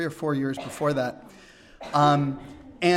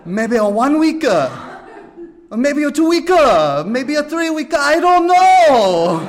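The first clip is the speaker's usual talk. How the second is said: put-on high, loud Japanese-accented English with a laugh; imitating Toshi; 8 semitones higher, 8 dB louder, slower than usual. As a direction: drag out I don't know slowly. Toshi answers how long he stays.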